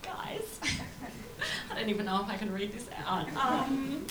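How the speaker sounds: soft, giggly fake modesty; mock shy humblebrag, quietly giggled; faux embarrassed. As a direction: fake bashful, hushed and giggling. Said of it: "acts all faux embarrassed".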